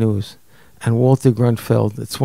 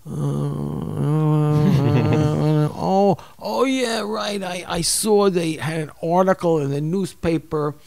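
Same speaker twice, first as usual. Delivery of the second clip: hesitant, higher fumbling voice; dumbfounded younger self; 4 semitones higher than usual. Retold or imitated. Husband mocks his younger self's clueless reply. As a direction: stammer like a clueless young man. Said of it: "and I'm like".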